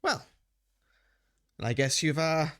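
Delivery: a hint of a spoiled aristocrat; prissy noble.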